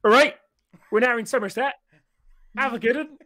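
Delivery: hoch und laut, wie eine fröhliche Zugdurchsage; kündige den Zug hoch und laut als gut gelaunter Schaffner an; Zugführer-Durchsage. Retold or imitated. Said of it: "the train driver go".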